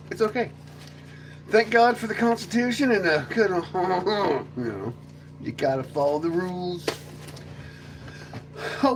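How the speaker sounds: normal talking voice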